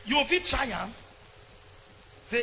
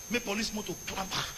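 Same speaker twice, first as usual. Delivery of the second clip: normal talking voice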